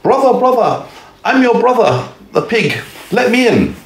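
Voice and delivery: very high, pleading voice; pleading wolf-pig. A narrator's reading. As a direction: beg your brother to open up, pitched high. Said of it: "and said".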